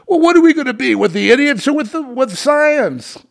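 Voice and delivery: high, loud squeaky gushing voice; mock fawning fan. Mocking sycophant voice.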